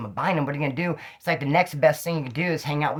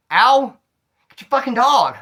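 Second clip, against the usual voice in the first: high, loud cry of pain and anger; pained yell; 7 semitones higher, 7 dB louder, slower than usual. Retold or imitated. Bitten by the dog, yelling at the owners loudly. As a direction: yell at them to pull the dog off.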